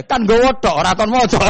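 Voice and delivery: loud squealing punchline; shouted laughing punchline. Acted. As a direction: yell the punchline while cracking up.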